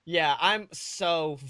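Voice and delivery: normal talking voice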